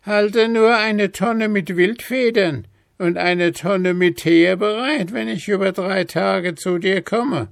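hohe, langsame, verschlagene Altweiberstimme; listige Alte. A narrator's reading. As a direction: lockend ein Angebot machen. Alte Frau gibt Anweisungen. (hohe, listige Hexenstimme)

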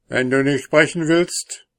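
lauter, leicht drohender Ton. Acted. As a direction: ein Hauch von Drohung. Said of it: "drohte der Rittmeister". (der Rittmeister droht dem Soldaten, etwas lauter)

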